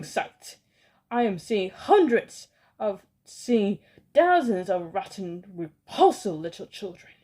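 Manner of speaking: loud, disgusted villain rant. Acted. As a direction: rage at the revolting children. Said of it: "cried the Grand High Witch".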